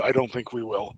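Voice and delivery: usual speaking voice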